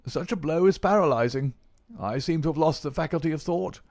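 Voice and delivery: effusive, high-pitched bookworm; old invalid professor, effusive. A narrator's reading. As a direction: plead and flatter in a high old man's voice.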